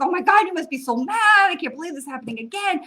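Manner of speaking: high, loud, fast imitation of shocked friends; shocked outrage. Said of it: "everyone else was like".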